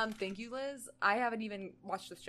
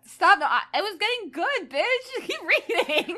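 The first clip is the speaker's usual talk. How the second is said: much louder and higher outcry; loud protest; 10 semitones higher, 11 dB louder than usual. Real, not acted. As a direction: yell in mock outrage. Protesting the reading stopping.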